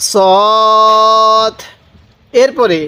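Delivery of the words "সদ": সদ with its vowel drawn out; elongated সদ in chanted recitation; four-alif elongation. Shown as a demonstration. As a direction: hold সদ long, as in Quran recitation. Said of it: "সদের মধ্যে চার আলিফ".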